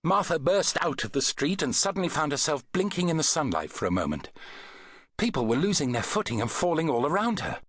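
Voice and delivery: ordinary delivery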